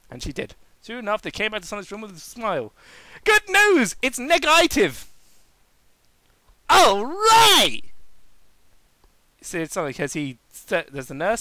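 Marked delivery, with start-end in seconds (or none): 3.2-5.1 high, loud bright relief; cheerful news. Acted. Nurse brings good news. 6.7-7.8 high, loud triumphant cheer. Acted. Sonic celebrates.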